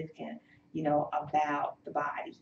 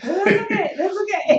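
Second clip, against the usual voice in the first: higher, louder, laughing as you comfort; laughing reassurance; 8 dB louder than usual. Real, not acted. Host laughing warmly to reassure the crying guest. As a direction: laugh it off kindly, much higher.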